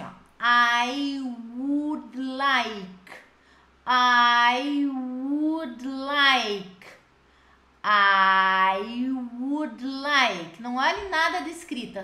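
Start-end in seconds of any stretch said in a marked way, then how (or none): 0.4-10.6 "I would like" stretched very slowly, syllable by syllable; slow model phrase. Shown as a demonstration. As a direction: drag out each word for the class to copy. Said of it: "só repitam agora com a teacher, assim". ("I would like" bem lento e pausado)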